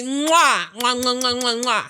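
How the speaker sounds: kissing sounds; between kisses. Real, not acted. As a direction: blow kisses to the audience. Blowing kisses at sign-off.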